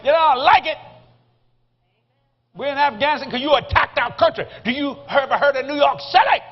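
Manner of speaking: loud, high mock-angry tirade; imitating wife's tirade. Wife nailing the cab driver to the wall, mock angry. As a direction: lay into him, hammed-up. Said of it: "she's nailing this guy to the wall".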